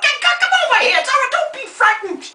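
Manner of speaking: high, theatrical coaxing; high reassurance. Acted. Old star soothing her little helper, high and theatrical.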